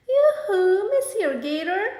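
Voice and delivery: loud, high sing-song call. Acted. Possum swinging out of reach calls the gator, loud and high. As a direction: hail him teasingly from the tree. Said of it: "she calls".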